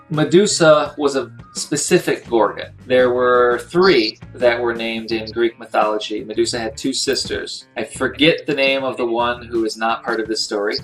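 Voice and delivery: ordinary delivery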